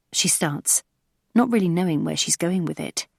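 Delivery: usual speaking voice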